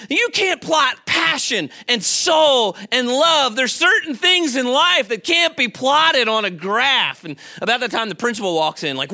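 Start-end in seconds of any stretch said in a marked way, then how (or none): 0.1-7.2 slow, raised-pitch retold speech; impassioned teacher. Teacher rallying students against graphing poetry, impassioned retelling. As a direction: declaim it like a teacher firing up a class, drawing out the key words. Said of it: "And he says".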